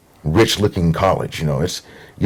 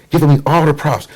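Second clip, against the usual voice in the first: usual speaking voice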